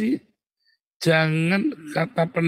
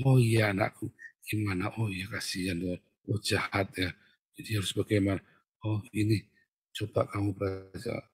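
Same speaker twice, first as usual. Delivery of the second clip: soft, lower soothing voice; gentle counsellor; 4 semitones lower, 6 dB quieter than usual. Acted. Counsellor to an ordinary churchgoer, tender sympathy. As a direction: gently comfort a troubled layperson. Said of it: "saya ngomongnya".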